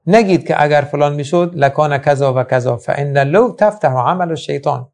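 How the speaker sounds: ordinary talk, nothing marked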